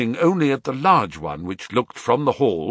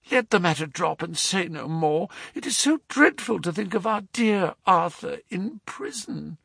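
put-on higher, pleading young lady's voice; anxious young woman; 10 semitones higher than usual. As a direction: beg him to believe you. Mary begs her uncle.

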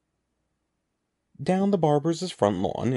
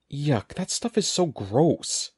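slow, higher-pitched cry of disgust; grossed-out, stretched-out exclamation; 4 semitones higher, slower than usual. A narrator's reading. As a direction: draw out the revulsion. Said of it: "he cried".

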